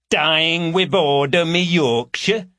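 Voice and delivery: put-on grotesque parody of a broad Yorkshire accent; mock Yorkshire accent. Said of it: "grotesque parody of a Yorkshire accent".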